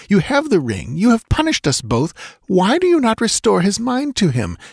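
high, pleading-angry woman's voice; bitter retort. A narrator's reading. Dancer demands her lover's mind restored, bitter. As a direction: plead and accuse in one breath. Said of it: "she retorted bitterly".

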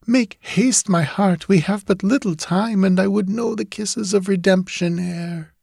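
put-on high pleading girl's voice; a yearning ghost girl, lilting; ghostly young woman. Julie urges Ned.

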